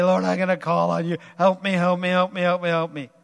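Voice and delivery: loud pleading cry for help; desperate plea. Retold or imitated. Preacher voicing a desperate believer, loud pleading. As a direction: beg the Lord loudly.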